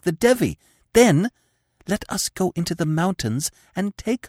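high, intense, urgent persuasion; passionate pleading. Acted. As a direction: tempt him with passionate urgency. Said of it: "she argued passionately".

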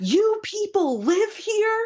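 high, drawn-out mock yell of disbelief; imitated yell. Woman stepping off a plane into Arizona heat, mock yelling. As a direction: imitate someone horrified, stretched out and high-pitched. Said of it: "she just yells at everyone".

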